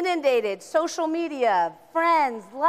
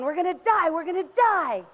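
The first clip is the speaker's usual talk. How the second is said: mock panic; 5 semitones higher than usual. Body panicking in fight or flight.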